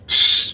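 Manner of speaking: voiceless "shh" through the teeth; a drawn-out shush to himself; hushing hiss. Retold or imitated. Prisoner watching his rival, voiceless hushing hiss. Same hushing sound before cursing Moose.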